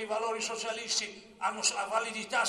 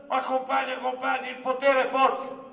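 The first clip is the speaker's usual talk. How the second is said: raised, higher pleading protest; objecting; 4 semitones higher, 8 dB louder than usual. Retold or imitated. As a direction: protest loudly to the comrades. Acts out an assembly objection. (obiezione a voce alta)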